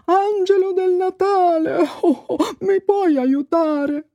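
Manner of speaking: high, drawn-out plea; crying out. A narrator's reading. Babbo Natale calling the angelo del Natale, pleading cry. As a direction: call up to the sky for help. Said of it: "Gridò".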